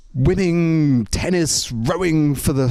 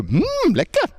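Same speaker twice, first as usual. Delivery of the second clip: delighted lekker; 11 semitones higher than usual. Shown as a demonstration. Showing students what to say when eating, exaggerated delight. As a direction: relish "lekker" with exaggerated high-pitched delight. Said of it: "You have to go".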